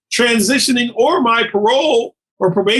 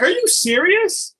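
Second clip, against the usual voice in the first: incredulous; 8 semitones higher than usual. Acted. Rejected applicant answering the employer over a fifth-grade D, incredulous. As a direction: imitate a stunned job applicant, voice shooting up.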